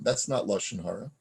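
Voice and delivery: usual speaking voice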